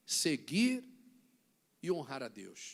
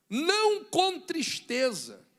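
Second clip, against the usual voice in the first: loud, slow emphatic sermon line; preaching emphasis; 6 semitones higher, 7 dB louder, slower than usual. Real, not acted. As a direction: land it hard and slow. Pregação em voz forte. (pregação forte e lenta)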